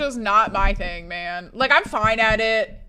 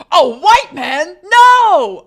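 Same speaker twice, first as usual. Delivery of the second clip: high, loud, rising to a shouted NO; hammed-up outraged yelp; New Girl quote; 6 semitones higher, 13 dB louder, much slower than usual. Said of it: "have you seen New Girl where he goes".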